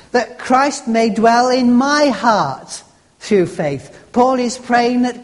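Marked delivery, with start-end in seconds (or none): 0.1-4.0 slow reading with "my" held up and leaned on; stress on my. Shown as a demonstration. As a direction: make "my" personal by stretching it out. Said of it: "Drop yourself into the Bible verse".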